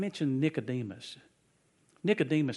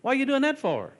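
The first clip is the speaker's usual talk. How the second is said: baffled; 13 semitones higher, 8 dB louder than usual. Retold or imitated. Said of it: "he said".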